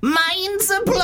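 very high, long-held mock-amazed cry; piercing squeal. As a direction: squeal it slowly so it goes right through them. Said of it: "that really goes through me".

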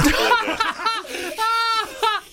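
high mouth-made explosion noise; nuclear bomb sound effect. Acted. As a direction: imitate a bomb going off, high and sustained. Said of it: "sound effects of a nuclear bomb".

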